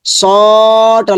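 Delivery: "স" stretched out long on a level note; "সটা" with a drawn-out first vowel; lengthened vowel. Shown as a demonstration. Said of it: "লম্বা হবে".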